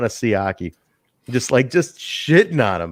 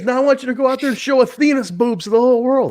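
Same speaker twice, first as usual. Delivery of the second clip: much higher, fast hammed-up voice; mock order; 13 semitones higher, faster than usual. Host voicing Sanders' crude command, high and fast. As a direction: bark the crude order gleefully.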